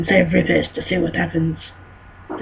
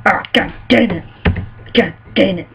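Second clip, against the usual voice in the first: normal talking voice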